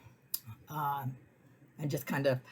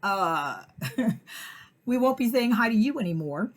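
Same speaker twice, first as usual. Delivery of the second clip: louder, dry goodbye; sarcastic brush-off; 6 semitones higher, 7 dB louder than usual. Retold or imitated. Narrator dismissing the pit bull owner, sarcastic and louder. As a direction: send them off with sass. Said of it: "I'm like".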